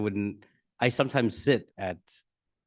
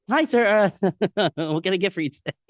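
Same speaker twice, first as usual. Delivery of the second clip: mock sugary service voice, much higher and louder, a bit fast; fake polite worker; 11 semitones higher, 7 dB louder than usual. Worker caught swearing on the headset turns fake polite, high and loud. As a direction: switch to sweet customer-service mode.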